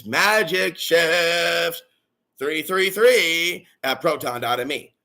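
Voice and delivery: slow, drawn-out spelling out of the email; slowed-down repeat. Real, not acted. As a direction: stretch "magicshift" and "three three three" out slowly. Repeats the email slowly for listeners.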